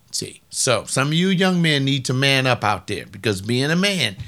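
ordinary talk, nothing marked

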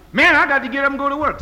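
a loud, fast, mocking whine; hammed-up lazy excuse; mock complaint. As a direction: throw the excuse back loud and quick to mock it. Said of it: "quoted excuse again".

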